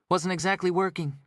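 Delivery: detective joking about the blown cover; grinning detective. Acted. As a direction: tease her with a grin. Detective grins and jokes.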